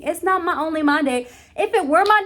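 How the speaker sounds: wife insisting the wedding belongs to both; insistent. Real, not acted. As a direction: punch my, insisting. Insisting about shared day.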